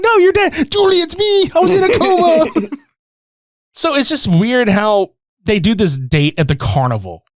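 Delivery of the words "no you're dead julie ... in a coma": high, hammed-up movie dialogue; mock dialogue. Acting out both lovers at the graveyard, high and hammed-up. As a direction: ham it up like a cheesy reunion. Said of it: "And she's like".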